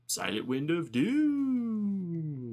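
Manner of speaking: slow, drawn-out, booming announcer voice; dramatic announcer. Acted. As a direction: drag out the name like a movie trailer. Announcing caller name dramatically.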